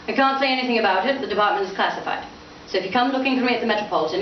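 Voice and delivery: fast, brushing it off; hurried. Acted. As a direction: rush the secret part. Spire rushes past secret job.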